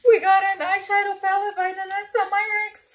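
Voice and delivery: high, loud excited reveal; squealing over a palette she wanted; thrilled announcement. Real, not acted. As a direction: announce it like you can't believe your luck.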